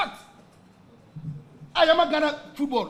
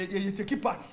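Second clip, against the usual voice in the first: normal talking voice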